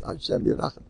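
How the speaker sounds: whispered mock-pious sigh of sympathy; pitying whisper. Rabbi mocking his own pity for a complaining man, whispered. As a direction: breathe it out like feigned pity.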